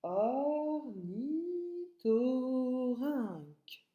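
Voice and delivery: "Ornithorynque" as or, ni, tho, rynque, long drawn; broken into syllables. Shown as a demonstration.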